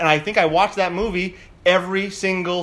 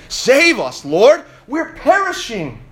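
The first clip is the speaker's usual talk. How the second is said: louder, slowed cry for rescue; desperate plea; 7 semitones higher, 5 dB louder, slower than usual. Acted. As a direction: beg Save to the Lord. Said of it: "the disciples went and woke him, saying".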